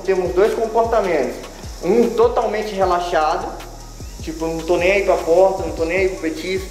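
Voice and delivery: ordinary talk, nothing marked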